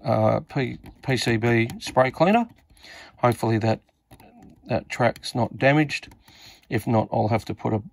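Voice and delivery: usual speaking voice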